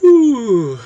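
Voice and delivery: high, loud laughing "ooh"; real burst of tickled delight. Real, not acted. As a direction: whoop an amused "ooh", falling from high. Delighted reaction to blurb's joke.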